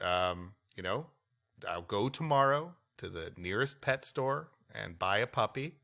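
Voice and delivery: ordinary talk, nothing marked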